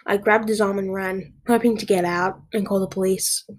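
usual speaking voice

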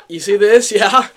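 loud, high, gleeful reveal; showing off. Retold or imitated. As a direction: pop out with a cocky question. Popping out to show off injury.

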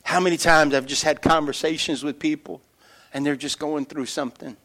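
ordinary talk

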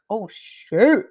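loud and high surprise; surprised. Retold or imitated. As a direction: blurt it out in shock. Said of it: "And I was like".

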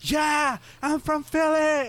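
high, loud excited squeal; screaming tourist girl. Retold or imitated. Girl without a mask bragging to strangers, high screaming imitation. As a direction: shriek it like an overexcited tourist. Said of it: "starts, like, screaming".

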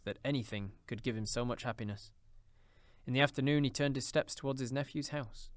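plain reading voice

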